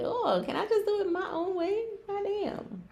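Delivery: high-pitched, put-upon whine; exasperated whine. Real, not acted. Host protesting being told how to do it, exasperated whine.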